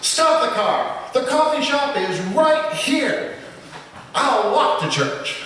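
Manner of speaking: a hammed-up exasperated moose yelling; exasperated shout. A narrator's reading.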